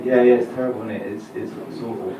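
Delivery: mumbled insincere yeah yeah; fake agreement. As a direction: pretend to care. Feigning agreement, bored.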